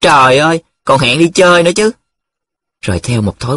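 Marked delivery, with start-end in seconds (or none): 0.0-1.9 high-pitched excited exclamation; crying out. Acted. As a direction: exclaim in teasing amazement. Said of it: "Nhiệm lại la lên". (la lên phấn khích)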